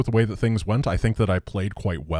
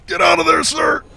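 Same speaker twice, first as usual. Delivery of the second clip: much higher, loud frantic yell; panicked shout; 6 dB louder than usual. Real, not acted. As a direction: scream at the unit to flee. Yells at fleeing unit.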